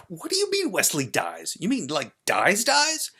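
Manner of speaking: high, loud, shocked outburst; screamed disbelief. Acted. Child hearing of Wesley's death, screaming in disbelief. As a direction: scream it, peaking on the last word. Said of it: "And I screamed".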